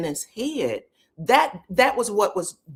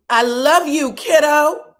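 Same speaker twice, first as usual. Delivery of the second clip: louder, higher retold declaration of love; emphatic affection; 6 semitones higher, 8 dB louder, slower than usual. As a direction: belt out the endearment, acting her part. Acted quote, repeated louder.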